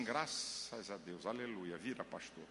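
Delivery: low, hushed congregant's aside; murmuring church member. Acted. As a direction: mumble it softly like someone in the pews. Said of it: "Tem irmão dizendo assim".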